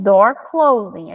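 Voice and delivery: very slow, each word apart; slow teaching voice. Shown as a demonstration. Teacher naming the card slowly to the child. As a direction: stretch it out for the child. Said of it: "tu mostra o cartão".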